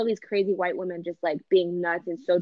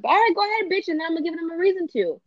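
raised-pitch pretend challenge; defiant comeback; 8 semitones higher than usual. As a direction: egg them on, pitched up and forceful. Acts out defiant comeback to caller.

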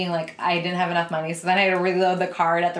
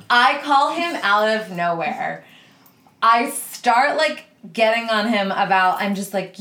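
ordinary delivery

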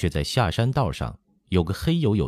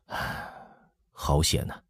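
soft, sighed-out relief; hushed, airy sigh of relief; 3 semitones lower, 5 dB quieter than usual. A narrator's reading. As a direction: let the breath go after a close call. Relief after the leopard leaves.

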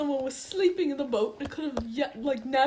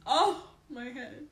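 pained cry; slower than usual. Acted. Character hurt, groaning.

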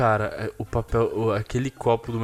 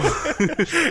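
laugh. Real, not acted.

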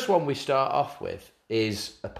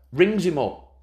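ordinary delivery

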